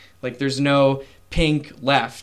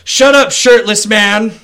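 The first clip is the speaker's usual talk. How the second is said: much higher, much louder barking guard; guard's yell; 8 semitones higher, 11 dB louder than usual. Acted. Guard to the shirtless prisoner, yelling him down. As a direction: silence the prisoner with a shout.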